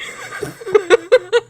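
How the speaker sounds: high bright burst of laughter; gleeful laugh. Real, not acted. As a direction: laugh at your own prank.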